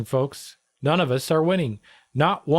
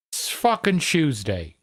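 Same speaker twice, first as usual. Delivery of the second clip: ordinary talk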